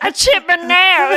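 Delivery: loud, high hammed-up whine; exaggerated fake distress; mock wailing. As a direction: wail over a trivial mishap. Mock wail over trivial complaint.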